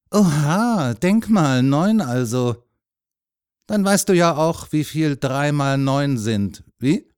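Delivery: put-on marvel, then test the child playfully; animated, stretched-out teasing; man quizzing a boy. A narrator's reading.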